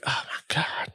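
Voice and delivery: breathy disbelieving whisper. Retold or imitated. Watching the scene unfold, whispering oh my God. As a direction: whisper it under your breath. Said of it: "whispered aside".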